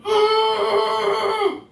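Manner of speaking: sharp high gasp of dismay; shocked high gasp; loud gasp. Real, not acted. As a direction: gasp loudly at the spill. Spills lemon zest on floor.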